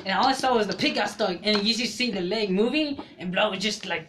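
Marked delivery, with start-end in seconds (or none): none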